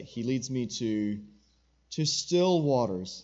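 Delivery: "still" lifted and stretched in a slow reading; slow stress on still. Shown as a demonstration. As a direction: linger on "still waters", pressing "still". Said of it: "the emphasis isn't on drinking".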